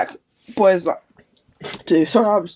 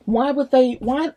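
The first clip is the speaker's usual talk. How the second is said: normal talking voice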